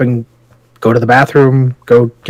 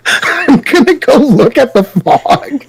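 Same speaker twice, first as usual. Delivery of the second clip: slow, higher, through laughter; laughing repeat; 10 semitones higher, slower than usual. Retold or imitated. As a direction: repeat the line cracking up. Repeating quote while laughing.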